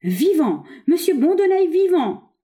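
high, loud rejoicing shout; crowd's jubilant cry. A narrator's reading. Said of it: "le même cri s'échappa de cent mille poitrines".

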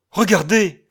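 loud, high urgent shout; excited cry. A narrator's reading. Man holding out calendar to companions, urgent cry. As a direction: cry out for them to see. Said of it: "cria-t-il".

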